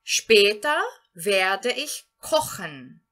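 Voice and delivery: German read very slowly and clearly; slow model sentence. Shown as a demonstration. Teacher gives the next example sentence to students, very slowly. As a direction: lay out each word slowly. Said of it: "Outro exemplo que nós temos aqui".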